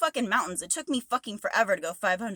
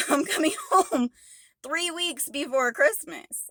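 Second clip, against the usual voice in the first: laughing; 5 semitones higher, slower than usual. Real, not acted. Laughing about surprise trip home.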